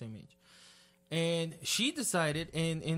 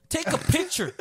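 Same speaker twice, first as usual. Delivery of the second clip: mock shout; 12 semitones higher, 7 dB louder than usual. Mocking wedding guests, shouting.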